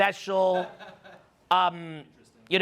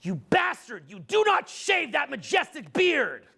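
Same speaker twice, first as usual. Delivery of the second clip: rising to a high, slow mock shout; mock outraged ex; 6 semitones higher, slower than usual. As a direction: yell it like a horrified ex-girlfriend. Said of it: "and go".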